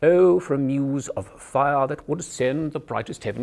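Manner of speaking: "Oh, for a muse of fire" in a crisp upright British RP; narrow-mouthed Received Pronunciation recital; upper class British accent. Shown as a demonstration. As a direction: speak it through a crack in a door, British RP. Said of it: "imagine you're speaking through a crack in a door".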